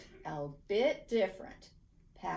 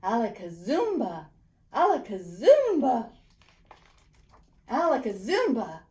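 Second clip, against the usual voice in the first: loud magic incantation; 9 dB louder than usual. A narrator's reading. Boy casting his magic word, loud and proud. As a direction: shout the magic word with a flourish.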